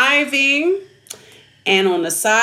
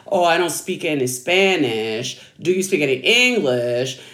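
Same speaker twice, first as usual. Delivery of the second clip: lightly voiced driver, pitched well down; low-pitched mock brush-off of a stranger; retold clueless driver; 8 semitones lower than usual. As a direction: imitate him in a low, unbothered register.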